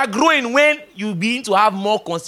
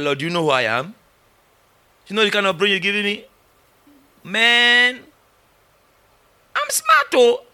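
mock protest. Young self protesting to God.

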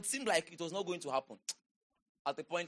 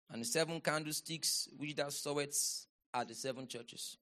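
low, soft recitation; subdued scripture reading; 4 semitones lower, 5 dB quieter than usual. Real, not acted. Preacher going back over the verse, low soft reading. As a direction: read it quietly, pitch well down.

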